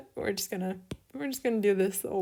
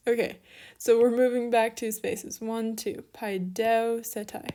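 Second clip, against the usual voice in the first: ordinary talk, nothing marked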